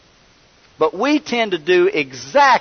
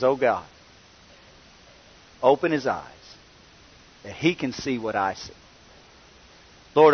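quiet prayer; 3 semitones lower than usual. Retold or imitated. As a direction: pray it gently, almost to yourself. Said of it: "Elisha prays a very simple prayer".

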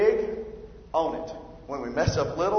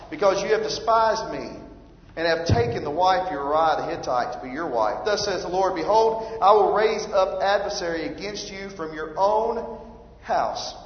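scripture reading; 3 semitones higher, 4 dB louder than usual. Acted. Reads scripture aloud.

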